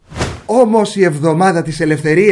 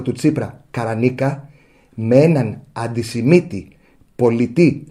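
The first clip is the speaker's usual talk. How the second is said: model journalist's answer; 5 semitones lower than usual. Acted. Journalist answering the minister as he deserves, deadpan and deliberate. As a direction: address the minister gravely, phrase by phrase. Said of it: "του απαντάς όπως πρέπει, δηλαδή έτσι".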